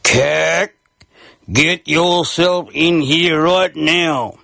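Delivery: slow, stern father calling out; slow bellow. A narrator's reading. Dad calling Cack in from the kitchen, slow stern bellow. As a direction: draw it out like an annoyed dad. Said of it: "strong, slow bellow".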